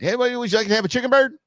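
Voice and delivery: high, fast mock waiter voice; mock host offer. Mock server offering chicken bird, high and fast. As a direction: chirp it out like an eager server.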